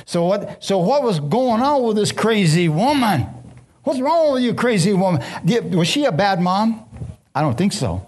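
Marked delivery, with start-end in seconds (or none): 3.8-5.2 mock exclamation. Preacher mock-scolds Rebecca.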